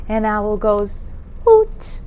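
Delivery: ordinary talk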